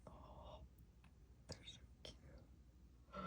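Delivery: soft whispering to kittens; breathy whispered cooing. Real, not acted. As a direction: hush so the kittens stay.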